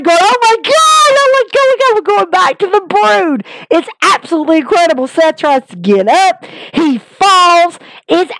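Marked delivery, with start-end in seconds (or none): 0.2-3.4 much higher, squealing oh my God; thrilled fan screaming with joy; excited cheering. Real, not acted. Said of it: "We're all cheering".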